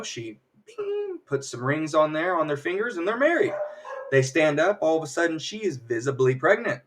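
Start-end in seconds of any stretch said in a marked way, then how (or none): 0.6-1.2 soft, very high held chime; ping like a tiny magic bell; high magic "bing". Retold or imitated. Witch conjures wedding rings, soft high "bing" noise.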